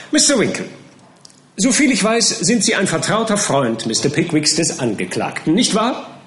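loud, high badgering; bullying counsel. A narrator's reading. Counsel Skimpin badgers Winkle over the name, loud and high. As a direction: hammer the witness with accusation.

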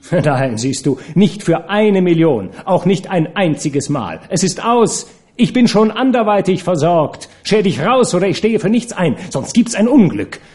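high-pitched angry ultimatum; threatening. A narrator's reading. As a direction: warn him off, pitch climbing with anger. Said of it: "Drohend ging sie auf ihn los".

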